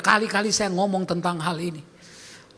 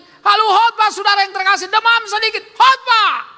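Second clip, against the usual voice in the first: loud, high, urgent pulpit exhortation; preaching at full force; 8 semitones higher, 3 dB louder than usual. Acted. Pastor urging the congregation to serve even when sick, preaching at full force. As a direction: build it up and hammer the congregation.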